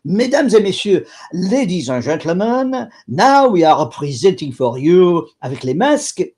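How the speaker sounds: put-on high, loud showman's French accent. Clown barker announcing the show to the street crowd, thick French accent. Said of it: "So he would talk like this".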